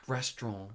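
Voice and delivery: "restaurant" said "restron", French-style ending; mock French "restaurant" ending in "-ron"; Boris Johnson restaurant. Mocking Boris Johnson's way of saying restaurant, a lightly French "restaurant". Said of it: "how Boris Johnson pronounces restaurant".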